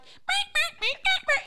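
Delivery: very high loud meows; imitating cat meows. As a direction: squeal like a demanding cat. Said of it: "she's just like".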